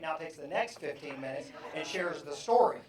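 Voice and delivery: ordinary delivery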